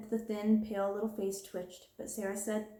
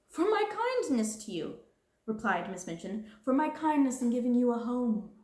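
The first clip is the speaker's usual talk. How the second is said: much higher, louder, stressing my kindness; self-righteous; 3 semitones higher, 4 dB louder than usual. Acted. Self-righteous insistence. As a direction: headmistress claiming her kindness, self-righteous.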